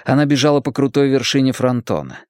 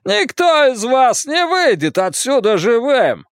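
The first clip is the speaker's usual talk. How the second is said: high, slow threat with notes of panic; 12 semitones higher than usual. A narrator's reading. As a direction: threaten while struggling to keep cool. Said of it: "Хейлс говорил высоким голосом".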